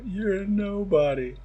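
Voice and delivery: slow mock-dramatic quote; imitating cosplayers. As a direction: ham it up like a fan reenacting. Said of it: "And they just go".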